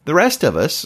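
ordinary delivery

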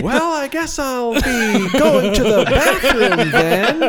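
high, slow, laughing fake-innocent excuse; mock polite thief slipping away; acted excuse. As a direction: act out a sneaky excuse to leave, laughing. Acting the thief leaving.

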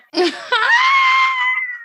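very high, loud shriek of laughter. Real, not acted. Friend shocked by the dating story, shrieking laugh. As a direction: burst into a screeching laugh.

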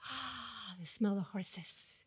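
soft, slow, savoring sigh; dreamy delight. Retold or imitated. Daughter delighting in the smell of horses, dreamy and soft. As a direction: breathe it in like heaven. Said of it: "Melissa goes".

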